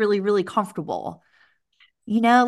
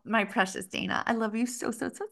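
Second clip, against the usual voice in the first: soft, rising tender words; 4 semitones higher, 7 dB quieter than usual. Real, not acted. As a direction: coo it softly to a dear friend. Tender goodbye to friend.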